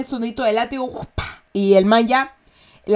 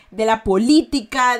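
ordinary delivery

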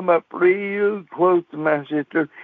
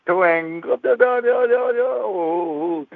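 higher, drawn-out twang and da-da plucks; banjo twang; 4 semitones higher, slower than usual. Retold or imitated. Boy kneeling and strumming his proposal, mock banjo. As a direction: pluck it out slowly with your voice.

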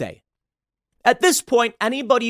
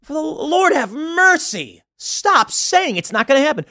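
a much higher, slow cry of exasperation; exasperated plea; 10 semitones higher, slower than usual. Real, not acted. The vlogger fed up with doubters, slow and high-pitched. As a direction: throw it up to heaven, fed up.

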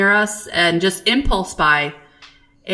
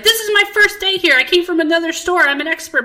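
high, fast eager-clerk voice; imitating a proud, eager clerk; peppy clerk; 10 semitones higher, faster than usual. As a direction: rattle it off like a proud new hire. Apple rep bragging, retold.

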